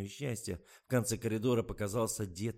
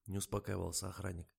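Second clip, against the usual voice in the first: plain reading voice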